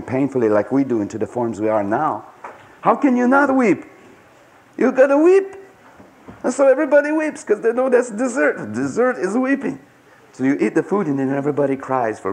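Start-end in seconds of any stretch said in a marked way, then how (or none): 2.8-9.8 impassioned outburst. Real, not acted. Passionate plea to weep.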